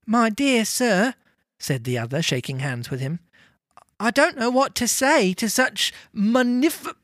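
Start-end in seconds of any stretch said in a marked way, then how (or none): 0.0-1.1 put-on slow, higher-pitched old gentleman; mildly overcome, drawn out; overwhelmed gentleman. A narrator's reading. Gentleman shaking Scrooge's hand, overwhelmed. 4.0-7.0 high-pitched, lost for words; overwhelmed gratitude. A narrator's reading. Gentleman thanks Scrooge for his generosity, overwhelmed.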